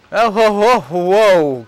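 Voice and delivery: high, loud string of whoas; impressed surprise. Acted. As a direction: exclaim in showy amazement. Game character reacts to solved puzzle.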